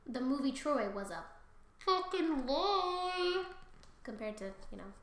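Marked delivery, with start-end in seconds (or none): 1.8-3.4 high, very slow stretched emphasis; drawn-out exclamation. Real, not acted. Viewer calling the movie Troy false, hammed-up stretched complaint. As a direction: draw the words right out. Exaggerated complaint about film.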